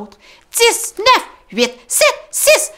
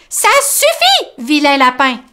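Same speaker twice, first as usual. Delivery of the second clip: Tom scolding; 4 semitones higher, 7 dB louder than usual. Acted. Tom to the rabbit, high loud scold. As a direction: tell off the naughty rabbit. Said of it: "s'écrie Tom".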